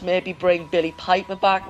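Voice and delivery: ordinary talk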